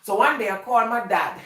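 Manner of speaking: ordinary talk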